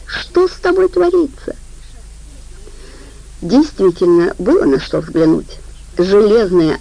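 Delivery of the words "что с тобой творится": high, slow worried question; shocked at her sister's tears; alarmed. Acted. Saika asks Aida in alarm, high and drawn out.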